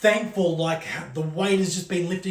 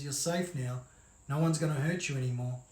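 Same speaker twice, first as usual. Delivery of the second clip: eerie calm; 6 semitones lower, 7 dB quieter, faster than usual. Acted. Creepy killer driver reassures her.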